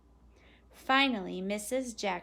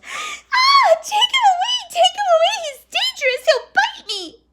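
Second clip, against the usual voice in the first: put-on piercingly high, loud girl in panic; terrified squeal; 18 dB louder than usual. A narrator's reading. Girl crouched away from the big dog, terrified squeal. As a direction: squeal for rescue from the danger. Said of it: "against the chair and squealed".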